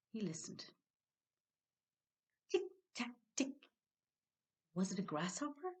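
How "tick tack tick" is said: crisp tick tack, like a tiny hammer; tapping sound. A narrator's reading. Storyteller imitating the leprechaun's hammer in a high voice. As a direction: tap the words out sharp and high.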